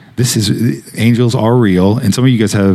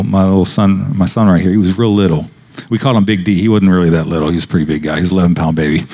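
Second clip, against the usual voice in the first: normal talking voice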